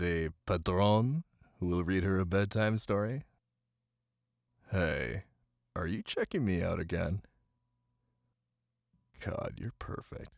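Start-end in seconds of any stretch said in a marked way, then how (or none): none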